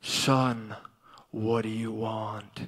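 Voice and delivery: very low, slow, solemn God voice; voice from the throne. Retold or imitated. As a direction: intone it deep and drawn out. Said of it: "Voice came off the throne again".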